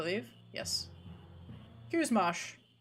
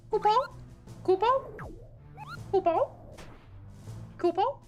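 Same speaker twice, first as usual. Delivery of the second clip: moogle voice; 10 semitones higher than usual. Acted. Moogle lines voiced high.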